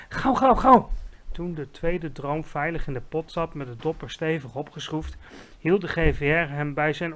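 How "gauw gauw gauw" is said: high, loud, insistent repeats; urgent shout. A narrator's reading. Giant rushing Sophie, urgent shout. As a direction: hurry her along at full voice. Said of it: "riep hij".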